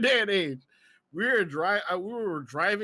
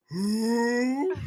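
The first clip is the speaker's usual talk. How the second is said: higher, long-held "who"; imitated hooted who; 4 semitones higher than usual. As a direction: stretch it into a mock oblivious hoot. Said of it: "the way they're just like".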